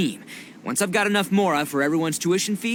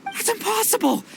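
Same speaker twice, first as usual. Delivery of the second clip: shocked disbelief; 14 semitones higher than usual. Acted. Itto exclaims in disbelief.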